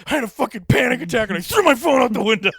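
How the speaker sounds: high, loud panicked rant; panicked shout. Retold or imitated. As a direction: yell it in panic. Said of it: "he was like".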